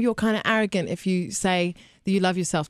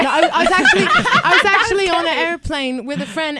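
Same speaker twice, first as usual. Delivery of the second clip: ordinary talk, nothing marked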